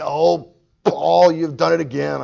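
loud, high mock exasperation; grumbling complaint. Mimicking how Jesus doesn't respond to Paul, exasperated grumble. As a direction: complain loudly, as if fed up. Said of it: "He doesn't grumble and complain".

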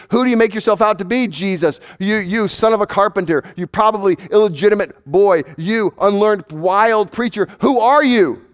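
jeering accusation. Acted. Crowd's insults hurled at Jesus.

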